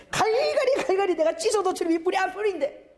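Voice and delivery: high, stretched-out emphasis; worked-up retelling. Retold or imitated. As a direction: act out ripping it to shreds. Acts out furious tearing to shreds.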